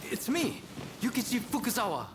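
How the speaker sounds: high, eager surprise; surprised recognition. Acted. Fukuzawa recognizing the French officer, surprised. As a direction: catch him before he strikes.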